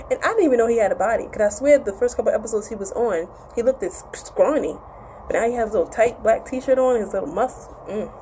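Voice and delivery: normal talking voice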